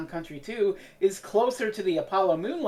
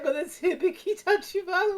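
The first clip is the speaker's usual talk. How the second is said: much higher, laughing while speaking; 11 semitones higher than usual. Real, not acted. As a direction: giggle through the name. Laughing at the post.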